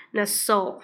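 ordinary talk

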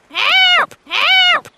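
high squawking parrot call for help. Acted. Parrot screeching its call for help.

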